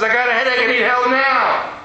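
hammed-up tough guy insisting on help right now; demanding big man.

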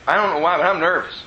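normal talking voice

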